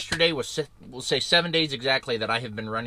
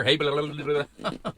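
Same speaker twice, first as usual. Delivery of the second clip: auctioneer patter; 3 semitones higher than usual. Retold or imitated. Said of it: "Sound like an auctioneer".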